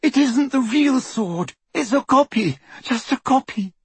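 high-pitched tearful plea; sobbing. A narrator's reading. Tortured girl protesting to her interrogator, sobbing. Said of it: "Hermione sobbed".

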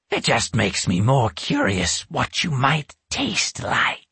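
lightly voiced menacing witch; a hint of a hungry witch. A narrator's reading. Witch teases about eating him.

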